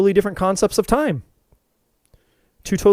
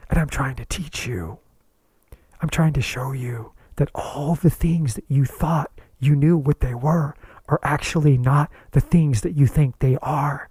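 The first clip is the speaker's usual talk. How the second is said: voice of the spirit; 4 semitones lower than usual. Acted. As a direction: speak as the spirit, gently insistent. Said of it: "your spirit is trying to send you a message saying".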